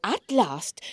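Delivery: plain reading voice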